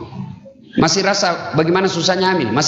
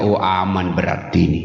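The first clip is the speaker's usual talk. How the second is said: much lower, smug aside; sly low aside; 11 semitones lower than usual. Pretend. Husband plotting at the well, smug and low. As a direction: mutter it slyly to yourself.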